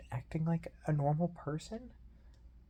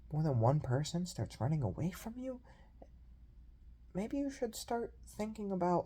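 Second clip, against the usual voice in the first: ordinary delivery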